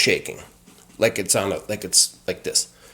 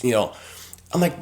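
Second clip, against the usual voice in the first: normal talking voice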